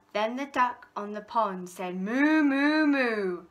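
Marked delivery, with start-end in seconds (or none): 1.9-3.4 louder lowing moo; cow moo. A narrator's reading. Duck pretends to be cow.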